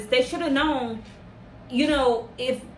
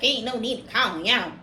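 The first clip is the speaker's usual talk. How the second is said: mock attitude; 4 semitones lower than usual. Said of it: "with an attitude".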